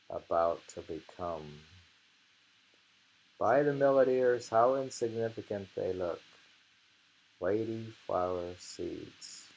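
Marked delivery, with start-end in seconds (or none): 3.4-9.4 louder, deliberate poem reading; poem recitation, louder. Acted. Rereading the haiku for both of them, declaiming.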